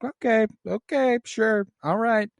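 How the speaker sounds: high, backing-off mock agreement; placating high voice. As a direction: humor her, raise your hands. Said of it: "And he's like".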